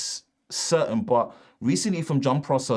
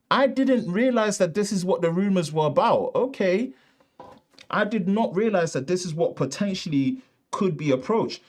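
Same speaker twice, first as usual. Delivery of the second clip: surprised; 7 semitones higher than usual. Real, not acted. Surprised by rumor.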